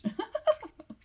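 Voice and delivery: high-pitched helpless giggle; real giggling fit. Real, not acted. Reader cracking up over botched German, real giggling fit. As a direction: burst into uncontrollable giggles. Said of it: "I'd be giggling this much".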